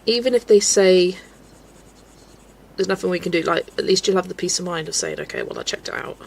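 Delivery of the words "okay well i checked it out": breathy whispered aside, voice dropped right down; hushed mock reassurance; whispered quote. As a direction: drop to a whisper for the imagined line. Hypothetical self-reassurance quote.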